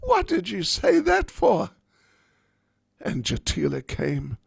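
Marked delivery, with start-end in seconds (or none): 0.0-1.7 high, loud cry of alarm; horrified at what her friend just said; panicked Radharani. Acted. Radharani panics as Lalita tells Jatila to look out the window. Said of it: "And Radharani thought".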